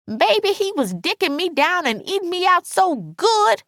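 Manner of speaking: vengeful, bragging friend; gloating Alice. A narrator's reading. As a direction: rub it in with high-pitched relish. Narrator voices gloating Alice.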